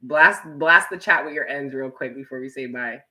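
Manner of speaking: normal talking voice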